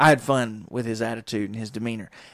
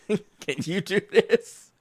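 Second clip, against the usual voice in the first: slow, higher, laughing repeat; laughing through it; 7 semitones higher, much slower than usual. Retold or imitated. Repeating the dishwasher taunt, laughing. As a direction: let the laugh take over the words.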